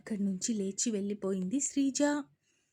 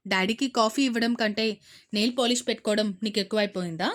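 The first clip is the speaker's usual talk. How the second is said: impatient, louder and quick; 6 dB louder, faster than usual. Acted. Father scolding daughter over coffee, impatient. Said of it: "అసహనంగా అడిగారు".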